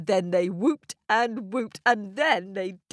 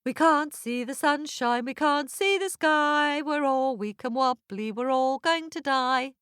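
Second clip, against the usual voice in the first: moaning lament; 7 semitones higher than usual. Acted. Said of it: "half howling and half moaning".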